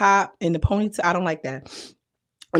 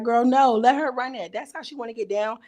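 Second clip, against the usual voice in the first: ordinary delivery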